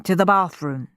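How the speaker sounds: ordinary delivery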